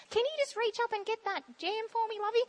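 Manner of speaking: high, soft imitated lady's voice; little old lady. Little lady in the shop asking a favour, high soft voice. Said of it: "little ladies in the shops".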